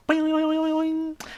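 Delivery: cartoon spring noises; springy boing. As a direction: bounce each boing. Sound effect whiskers.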